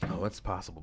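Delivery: ordinary talk, nothing marked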